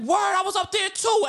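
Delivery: high, slow shouted excitement; mock barber shout. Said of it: "He's like".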